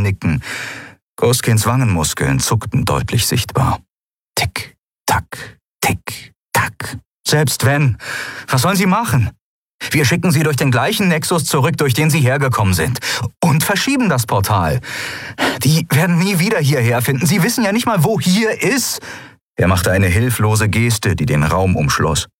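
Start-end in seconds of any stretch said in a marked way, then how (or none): 4.4-7.0 whispered tick, tack, slow and even. A narrator's reading. Narrator mimicking the ticking clock, whispered. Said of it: "Das Ticken der Standuhr". 7.3-19.0 higher, fast, hasty reasoning; Sephiron arguing. Acted. Sephiron hurriedly defending himself to the captain, hasty.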